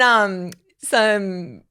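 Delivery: normal talking voice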